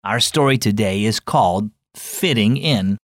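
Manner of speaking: usual speaking voice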